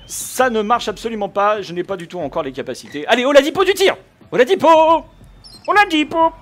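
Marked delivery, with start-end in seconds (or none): 3.1-6.3 cheering shout. Real, not acted. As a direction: shout the name, rising and loud. Cheering his player to shoot. (crier le nom avec enthousiasme)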